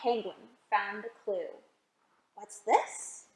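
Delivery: put-on storybook read-aloud for little kids; gentle picture-book narration; reading to children.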